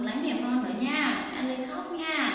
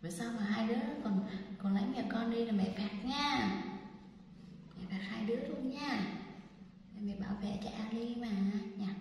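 ordinary delivery

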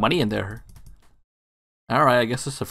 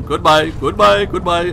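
high, loud, slow drawn-out goodbyes; loud sing-song goodbye; 7 semitones higher, 8 dB louder, slower than usual. Pretend. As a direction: sing the goodbyes out big as you flee.

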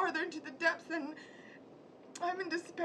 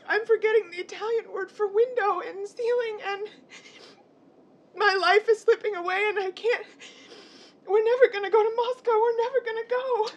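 tearful outburst; 5 semitones higher, 11 dB louder than usual. Acted. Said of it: "Don't cry".

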